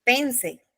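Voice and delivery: pense with the first syllable stressed and a falling end. Shown as a demonstration. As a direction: lean on PEN in pense. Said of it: "El que está leyendo dirá". (pense acentuado en la primera sílaba)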